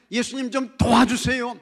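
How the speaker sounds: loud, high mock cry for help; acted plea. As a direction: call out to Jesus as if begging. Acts believer begging Jesus.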